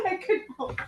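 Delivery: high, helpless laughing voice; real giggles breaking into the words; laughing apology. Real, not acted. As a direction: apologize while cracking up. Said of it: "I'm laughing out of character".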